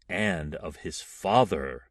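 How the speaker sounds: plain reading voice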